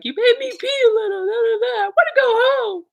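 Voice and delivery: high, fast, tearful wail; hammed-up weepy complaint, doing the most; mock crying whine. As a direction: sob it out like an overdramatic kid. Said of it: "how I was embarrassed".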